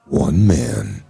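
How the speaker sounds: deep, dramatic trailer narrator; low epic announcer, slow and weighty; movie trailer voice. Shown as a demonstration. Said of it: "movie trailer voice".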